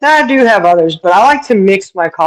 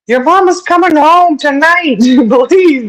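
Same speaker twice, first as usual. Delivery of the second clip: laughing plea; 10 semitones higher, 5 dB louder than usual. Retold or imitated. Pleading with the cat, laughing.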